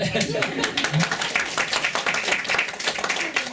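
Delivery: big loud burst of laughter. Real, not acted. Host laughing loudly at the speaker's closing joke.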